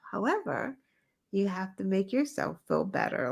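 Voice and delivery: ordinary delivery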